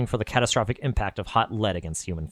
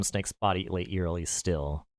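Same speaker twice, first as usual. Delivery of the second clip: plain reading voice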